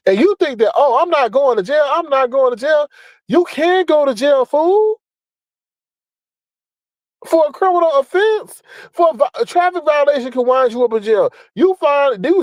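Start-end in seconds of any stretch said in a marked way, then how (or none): 0.7-2.9 higher-pitched mocking imitation; mock denial. Vlogger quoting the man's denial back at him, mockingly. 3.3-8.6 high-pitched, exasperated disbelief; incredulous scolding. Real, not acted. Vlogger telling a fool he can go to jail, incredulous. Scolds the man he just mimicked.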